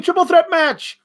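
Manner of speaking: high excited cheer; mock crowd cheer. The crowd hears the match announced, high and excited. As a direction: sell it like a thrilled fan. Crowd version of the same words.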